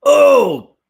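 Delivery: loud pained oh; real recoil from the taste; startled loud cry at a sip; gasp at bitter coffee. Real, not acted. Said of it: "drank a coffee".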